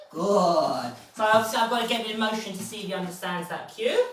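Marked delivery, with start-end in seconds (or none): 0.1-0.9 loud, stretched-out praise word; big approving praise; hearty praise. Real, not acted. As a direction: reward the dog with your voice. Praising the dog.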